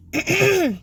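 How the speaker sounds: sharp, high throat clearing; loud throat clearing. Real, not acted. Hoarse reader clears throat.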